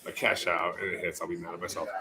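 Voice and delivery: low, quiet self-talk; nervous muttering. Real, not acted. Bettor arguing with himself over cashing out, nervous mutter. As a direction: mutter it low, under the breath.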